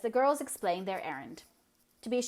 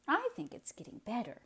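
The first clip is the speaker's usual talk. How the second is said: firm; 7 semitones higher than usual. Acted. Catherine firmly contradicting her gloomy sister Eliza. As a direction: stand your ground gently. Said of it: "said Catherine firmly".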